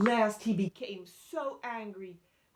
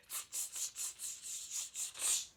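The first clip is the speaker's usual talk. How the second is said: string of sharp tss sounds; voiceless monkey chatter; monkey talk; 5 dB quieter than usual. A narrator's reading. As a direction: mimic monkeys answering back with tss. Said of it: "hands back at him and went".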